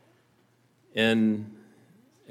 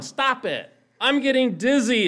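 high-pitched cry of protest, getting louder; acted scream; 11 semitones higher, 5 dB louder than usual. A narrator's reading. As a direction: cry out as the dizzy teacup. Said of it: "I screamed".

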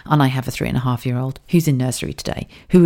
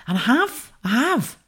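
voice breaking; 8 semitones higher, 4 dB quieter than usual. Real, not acted. Admits confidence loss, voice breaks.

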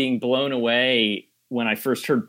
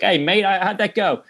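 high, cheery imitation of a British accent; 8 semitones higher than usual. British actor relaxing after the cut, light British accent. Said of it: "The guy's British".

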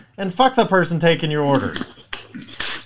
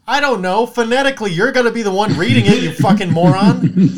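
mock exasperated rant; 5 semitones higher, 4 dB louder than usual. Mocking the barista who asks how to spell it, loud exasperated rant. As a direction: snap at the clueless barista.

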